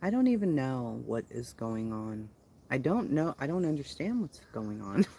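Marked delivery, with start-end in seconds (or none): none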